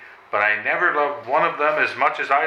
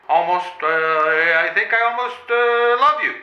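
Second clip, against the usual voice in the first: slow, then rising high and louder on love; halting declaration; 4 semitones higher, 4 dB louder than usual. Acted. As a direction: stammer up to love you, high.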